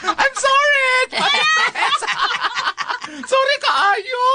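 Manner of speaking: high, laughing shout of sorry; laughing apology. Real, not acted. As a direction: apologise while cracking up with laughter. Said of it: "joke naman sa to". (nangayo og pasaylo samtang nagkatawa)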